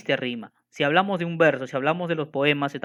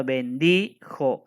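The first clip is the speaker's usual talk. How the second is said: bendijo broken up slowly, DI held; stress on DI; 2 semitones lower, much slower than usual. Shown as a demonstration. Teacher pointing out the last stressed vowel in bendijo, long stressed DI. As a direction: draw out the DI in bendijo, jo set apart. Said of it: "porque la última vocal acentuada".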